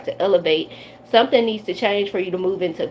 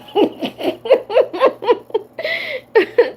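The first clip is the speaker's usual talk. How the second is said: high, loud burst of real laughter; high cackling laugh; big laugh; 3 dB louder than usual. Real, not acted. Cracks up at granny memory.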